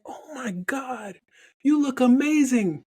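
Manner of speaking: high-pitched, drawn-out gushing; amazed greeting. Retold or imitated. Nurse amazed at how a returning patient looks, excited. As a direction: gush like an old acquaintance thrilled to see them.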